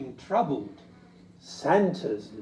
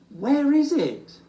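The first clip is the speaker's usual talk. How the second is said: high, loud, slow eager question; 12 semitones higher, 7 dB louder than usual. Acted. Little Bear asking Old Bear, eager and high. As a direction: beg to know.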